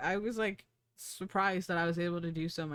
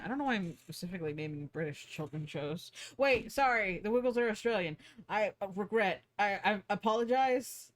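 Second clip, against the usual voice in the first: usual speaking voice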